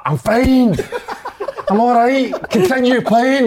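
high, loud mock bravado; imitated high-pitched protest; hammed-up insistence. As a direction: shout it like an injured player playing the hero. Acts injured player insisting he's fine.

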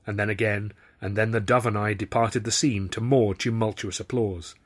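ordinary delivery

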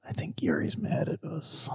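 soft, secretive whisper; hushed aside to a friend. Acted. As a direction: whisper it so Yuri won't hear. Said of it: "I think I go".

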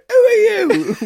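loud, very high mock challenge; high-pitched shouted impression; mock confrontation. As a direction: yell it like accosting a stranger. Imitates accosting strangers.

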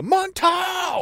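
high, slow excited call-out; hyped-up announcement; excited shout. Real, not acted. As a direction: announce it like a trailer.

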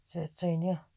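ordinary delivery, nothing marked

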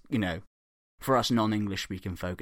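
ordinary delivery

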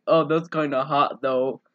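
low deadpan imitation; unexcited mock quote; monotone. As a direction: drop low and drain out the interest. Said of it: "saying in this monotone voice".

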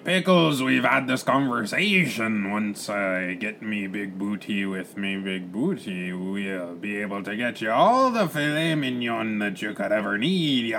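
drawn-out pirate brogue; weary pirate captain. Acted. Captain promises parrot filet mignon, pirate voice. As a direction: reason with the bird in a rolling pirate drawl.

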